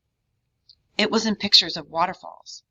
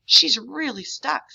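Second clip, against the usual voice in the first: straining to lift, slower and higher; strained; 8 semitones higher, 6 dB louder than usual. A narrator's reading. Cousin straining against stuck grandma, strained. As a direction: grunt it out mid-heave. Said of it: "sweating from the strain".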